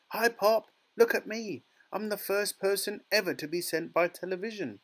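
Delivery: put-on high, mouse-like boy, boasting; tiny squeaky voice. A narrator's reading. Shrunken boy shows off to father, tiny squeak. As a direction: squeak it proudly, pitched right up. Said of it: "squeaking of a mouse".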